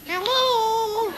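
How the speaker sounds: high squawky parrot "Hello". Acted. Voicing the parrot's cry.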